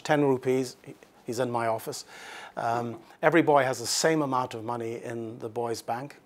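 ordinary talk, nothing marked

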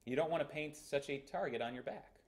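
normal talking voice

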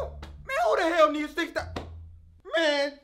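yelled out loud in disbelief; outraged shout. Acted. Cashier shouting at the huge order, loud disbelief. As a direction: blow up at the absurd order.

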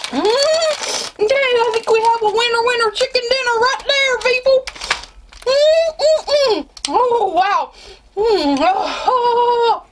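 very high strained voice; strained squeal. Real, not acted. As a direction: squeak through a puckered mouth. Strained by sour candy.

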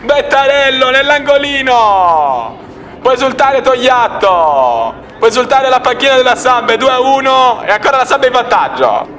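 breathless italian goal commentary. Real, not acted. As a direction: call the goal, stretching the key words. Goal call, live commentary.

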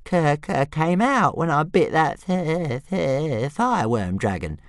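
high, slow stammering whine; little dragon whining over his tooth; whiny dragon. A narrator's reading. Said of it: "Toothless complained, whinily".

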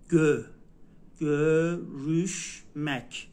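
"گو، روش" as gö-rüş, fronted; dotted ö and ü. Shown as a demonstration. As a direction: say "گو، روش، مک" in separate syllables with rounded front vowels. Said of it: "باید دقت بکنین که اینا نقطه". («گو، روش، مک» هجا به هجا، با ö و ü)

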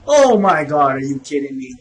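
normal talking voice

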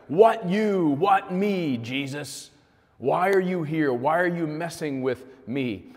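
lightly voiced demon scream; possessed man screaming. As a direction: shriek it like a tormented man. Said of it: "He's screaming at the top of his lung".

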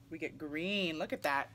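usual speaking voice

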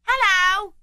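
put-on high-pitched boy calling back; boy's voice. Boy answers Scrooge in the street, high boyish call. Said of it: "returned the boy".

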